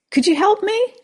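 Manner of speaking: high squeaky little frog. Acted. Frog calling up to a passing old man. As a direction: pipe up small from the ground. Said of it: "he hears a voice saying".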